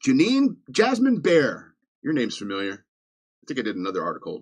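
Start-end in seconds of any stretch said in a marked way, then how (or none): 0.1-1.8 high, drawn-out name-calling; mock scolding. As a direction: call out the culprit in mock reproach. Publicly shames the article's writer.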